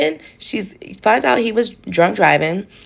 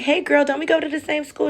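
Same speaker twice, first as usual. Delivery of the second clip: put-on much higher, bubbly "hey girl" greeting; excited greeting; 9 semitones higher than usual. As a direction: greet a schoolmate you just recognized. Meeting a schoolmate as a customer.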